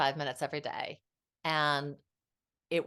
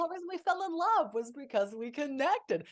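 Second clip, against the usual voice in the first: gushing; 12 semitones higher, 5 dB louder than usual. Real, not acted. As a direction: swoon about being connected. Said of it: "goo goo land".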